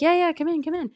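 retold eager welcome; welcoming old man. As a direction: lightly imitate an old man ushering a visitor in.

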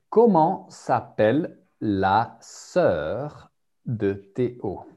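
slow, drawn-out question; slow teacher model. Shown as a demonstration. The teacher asks Fernanda slowly, spelling out each word. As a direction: stretch out each word for the learners. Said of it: "teacher models question slowly".

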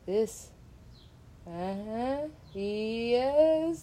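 usual speaking voice

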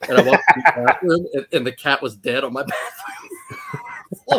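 laughing through the words; stifled giggles. Real, not acted. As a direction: giggles breaking into the story. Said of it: "I am genuinely laughing".

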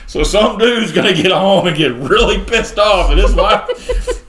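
high, slow, breaking up with laughter; real laughter while finishing the joke; laughing through it. Real, not acted. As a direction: laugh your way through the punchline. Laughing through his own punchline.

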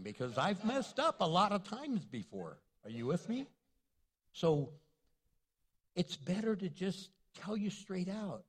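ordinary talk, nothing marked

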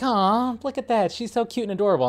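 high-pitched adoring coo; mock cooing. Acting out a fan's reaction to the actress, high cooing. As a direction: coo as if at something adorable.

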